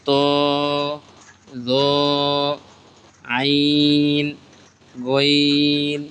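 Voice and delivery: letter names stretched long, one by one; slow, stretched Arabic letter names; drawn-out recitation. Shown as a demonstration. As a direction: draw out each letter name like a Quran teacher. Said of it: "আমরা এভাবে টেনে টেনে পড়বো".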